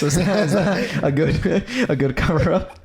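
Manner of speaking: laughing through the question; amused laughing question. Real, not acted. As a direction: giggle out the joke. Joking about fake gift excuse.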